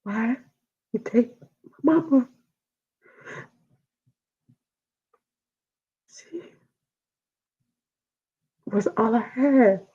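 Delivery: high, soft pleading; asking why mama was taken; real grief. Real, not acted. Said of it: "grieving, asking why".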